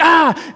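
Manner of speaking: high, loud mock scream; startled yelp. Startled by tap on shoulder.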